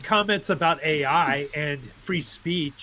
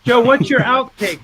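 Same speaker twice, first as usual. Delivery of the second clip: calling out; 6 semitones higher, 8 dB louder than usual. Real, not acted. Calling out to Joe.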